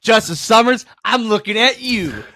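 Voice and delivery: high, loud hype shout; excited call-out. Real, not acted. Host hyping a listener, excited high call-out. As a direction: call him out with big excitement.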